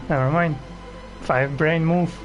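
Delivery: ordinary delivery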